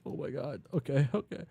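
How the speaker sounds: high-pitched mock panic; freaking out. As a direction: freak out as the flustered girl. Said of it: "she's freaking out, freaking out".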